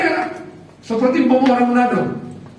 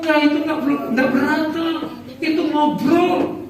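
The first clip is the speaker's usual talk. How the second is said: amused reassurance; 6 semitones higher than usual. Retold or imitated. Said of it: "wakil gembala bilang".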